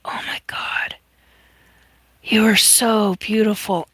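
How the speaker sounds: breathless whisper of wonder; awed whisper. Real, not acted. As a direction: whisper it, overwhelmed. Awed inner thought meeting daughter.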